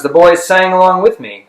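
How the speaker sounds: plain reading voice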